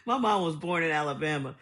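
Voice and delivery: ordinary talk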